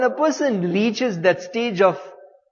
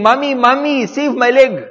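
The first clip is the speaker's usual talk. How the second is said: higher, pleading call for mother; mild mock yell, pitched up; retold scream; 6 semitones higher, 3 dB louder than usual. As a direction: imitate a boy crying out for rescue. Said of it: "he started screaming".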